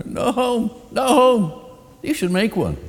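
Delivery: very high, loud, mocking bird; sing-song crow taunt, high; teasing crow voice. A narrator's reading. Crow giggles and mocks.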